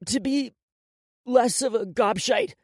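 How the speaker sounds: steel yourself and repeat it; upset boy. A narrator's reading. Crying boy repeats the forced promise, upset. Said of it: "He took a deep breath".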